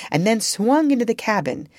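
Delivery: ordinary delivery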